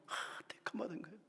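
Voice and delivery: soft whisper, quick; whispered aside. Real, not acted. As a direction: hush it to the listeners. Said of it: "aside after scripture".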